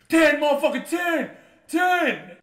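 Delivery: high, loud mock shouting; hard-time patient. Injured roommate answering the nurse's pain question, imitated shouting. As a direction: holler it like a hurt patient. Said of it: "he was giving her a hard time".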